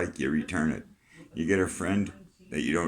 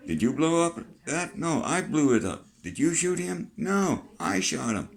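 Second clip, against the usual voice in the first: mock court exchange; 11 semitones higher, 4 dB louder than usual. Acting out court questioning exchange.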